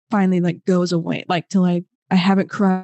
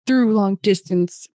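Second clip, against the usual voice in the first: normal talking voice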